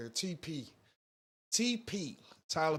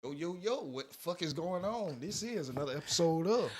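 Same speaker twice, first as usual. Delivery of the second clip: higher-pitched, energetic host opener; hype show intro; 5 semitones higher than usual. Acted. Host greeting listeners to another episode, hyped.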